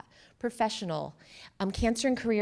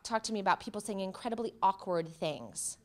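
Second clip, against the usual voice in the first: ordinary talk, nothing marked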